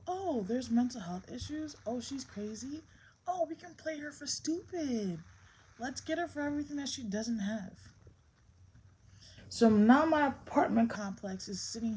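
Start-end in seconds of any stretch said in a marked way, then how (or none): 0.1-7.9 higher-pitched mock of gossiping people; mocking imitation. Mimicking people who play her for stupid, mocking. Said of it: "I hate when people go".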